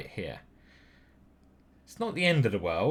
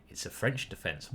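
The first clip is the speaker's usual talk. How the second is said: mock innocent aside; 5 semitones lower, 7 dB quieter than usual. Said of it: "and we say".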